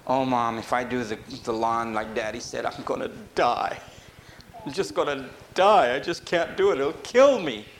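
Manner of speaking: lightly voiced child begging; whiny child to mommy. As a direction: mildly whine to mom that the chores will kill you. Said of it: "want God to be like your mommy".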